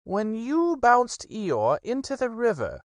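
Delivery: high, slow grave rabbit voice; slow important question; solemn Rabbit. Acted. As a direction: Rabbit the stern judge. Rabbit accuses.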